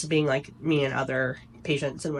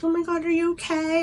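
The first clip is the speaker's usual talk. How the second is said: shocked nurse; 12 semitones higher than usual. Retold or imitated.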